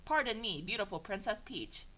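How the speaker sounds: normal talking voice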